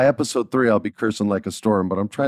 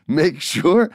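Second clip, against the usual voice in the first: normal talking voice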